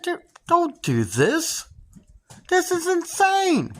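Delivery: high, slow frightened plea; scared pleading. Retold or imitated. To the driver holding a gun, slow high plea. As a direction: beg him slowly, voice up high.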